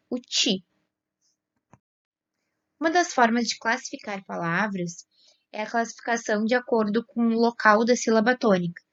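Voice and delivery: ordinary talk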